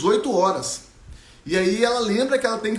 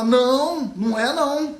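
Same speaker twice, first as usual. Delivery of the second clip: put-on girl's protest; 7 semitones higher, 4 dB louder, slower than usual. Girl protests to teacher.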